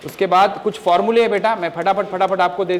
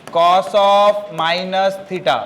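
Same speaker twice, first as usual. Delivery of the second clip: very slow, each word held long; slow dictation; much slower than usual. Shown as a demonstration. Teacher giving the second formula, slow dictation. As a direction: dictate word by word for note-takers.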